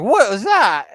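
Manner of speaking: retold burst of surprise; startled reaction. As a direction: blurt it out, taken aback.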